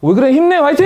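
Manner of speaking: high, loud cheerleading; overcheerful pep talk. Acted. Mom answers a tired child, hammed-up high cheering. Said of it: "이러면 또 안 된다고".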